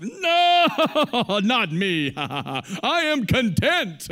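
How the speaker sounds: loud, laughing, high mock protest; mock denial. Imitating the congregation's denial of wanting, loud and laughing. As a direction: laugh it off like you'd never admit it. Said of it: "I think all of us were like".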